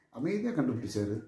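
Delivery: said softly, showing calm; quietly. Shown as a demonstration.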